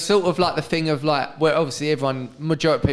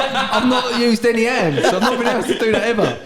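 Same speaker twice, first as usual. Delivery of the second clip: boastful Darren; 6 semitones higher than usual. Retold or imitated. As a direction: brag about riding hands-free.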